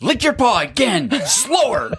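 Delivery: high, put-on commanding voice; hammed-up high-pitched pimp orders; mock cat pimp. Cat pimp ordering cats in ASMR.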